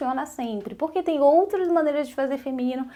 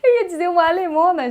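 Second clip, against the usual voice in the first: speaking through laughter, louder and faster; laughing; 6 semitones higher, 6 dB louder, faster than usual. Real, not acted. Teacher telling students her mistake, laughing. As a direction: still laughing while repeating the slip.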